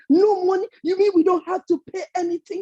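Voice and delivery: high, loud and quick disbelief; thrilled villagers can't believe it; excited. Acted. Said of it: "looked at each other excited".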